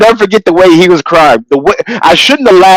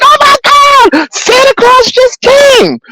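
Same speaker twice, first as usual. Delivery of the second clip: mock surprise. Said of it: "and we're like".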